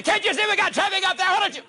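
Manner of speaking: frantic, very high, rapid-fire hollering; hammed-up road-rage outburst at top pitch; irate driver yell. Driver turns round to the honker behind, yelling frantically.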